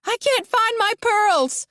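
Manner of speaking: high, slow panicked shout. A narrator's reading. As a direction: shriek about the lost pearls. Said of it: "she shouts".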